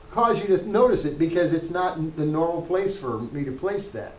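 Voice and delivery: ordinary talk, nothing marked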